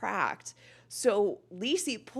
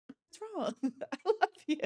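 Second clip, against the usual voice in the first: higher, sugary fake-loving voice with a laugh; fake sweet gaslighter; 7 semitones higher than usual. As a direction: coo it like an innocent gaslighter. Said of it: "show up and be like".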